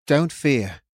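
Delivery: steady, calming reassurance; calm the whole crowd; reassuring. A narrator's reading. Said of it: "it was for everyone".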